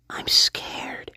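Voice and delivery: breathy mocking whisper of a scared woman; imitating a whisper. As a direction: whisper it like she is frightened. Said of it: "She says".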